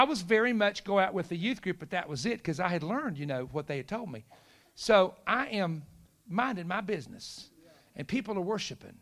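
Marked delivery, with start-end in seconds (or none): none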